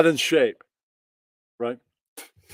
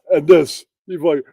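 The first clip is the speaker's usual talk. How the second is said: ordinary talk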